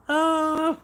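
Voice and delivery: very high, loud mock shark scream; Jaws shark scream. Vlogger doing Jaws for chat, screaming high and loud. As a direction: screech like a cartoon shark attacking. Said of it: "I can also do with a shark. Jaws".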